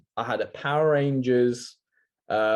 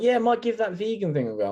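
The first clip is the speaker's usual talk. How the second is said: much higher mocking voice; light mockery, pitched way up; mimicked impressionable viewer; 10 semitones higher, faster than usual. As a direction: imitate someone easily won over.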